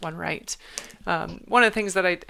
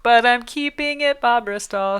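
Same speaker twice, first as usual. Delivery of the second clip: playful defiance; 6 semitones higher, 6 dB louder than usual. Real, not acted. Defiant reply to Bob Bristol.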